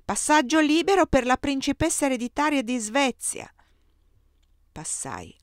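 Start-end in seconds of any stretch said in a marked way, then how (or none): 0.0-3.5 loud, high official proclamation; bellowed order to clear the way; roared announcement. A narrator's reading. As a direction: call it out down the line of gendarmes. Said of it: "ruggì".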